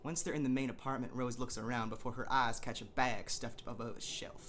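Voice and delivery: normal talking voice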